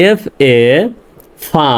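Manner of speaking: "এফ এ", a pause, then "ফা"; letters "এফ এ" first, "ফা" set apart after them; spelled syllable demo. Shown as a demonstration. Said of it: "ভেঙে উচ্চারণ করতে পারতেছি".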